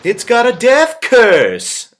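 high, slow imitation of Crazy Ralph; slow ominous drawl. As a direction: echo the clip's doom line. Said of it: "See, I sound just like him".

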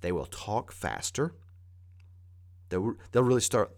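ordinary talk

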